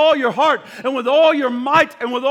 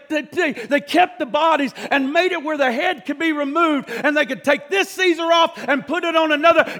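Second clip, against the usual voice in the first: ordinary talk, nothing marked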